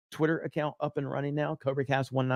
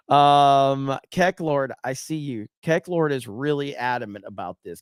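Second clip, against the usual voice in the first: ordinary talk, nothing marked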